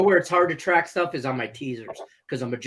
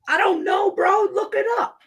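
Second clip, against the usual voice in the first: high, loud exasperated yell; annoyed shout; 4 dB louder than usual. Retold or imitated. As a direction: shout it like a fed-up friend. Acted quote of an annoyed reply.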